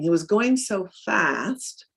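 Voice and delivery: ordinary talk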